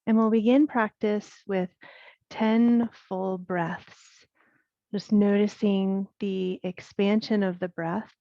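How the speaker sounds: unhurried yoga breathing guidance with long pauses; guided meditation. Acted. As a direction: guide them through the breath, leaving space between phrases. Leads guided breath practice.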